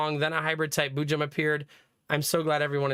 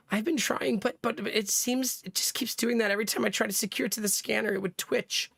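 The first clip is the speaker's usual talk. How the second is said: flustered Mei Ling; 7 semitones higher than usual. Acted. Mei Ling explaining the box trouble, high flustered voice.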